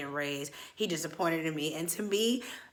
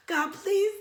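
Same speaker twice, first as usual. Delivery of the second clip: high mock whimper; pleading prayer; 13 semitones higher than usual. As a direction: beg God in hammed-up fear. Acted desperate prayer.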